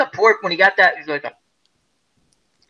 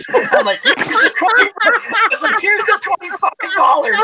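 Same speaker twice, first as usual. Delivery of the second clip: high-pitched laughing through the words; cracking up while offering the money; giggling as he dares coworkers. Real, not acted. Laughing while retelling dare.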